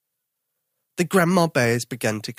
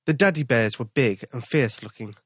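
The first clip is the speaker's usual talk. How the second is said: ordinary delivery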